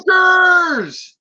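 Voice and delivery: huge high scream, pitch shooting way up; yelled warning. Retold or imitated. Movie villagers warning of raiders with full-throated scream. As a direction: scream it as the alarm call. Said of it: "would yell and scream".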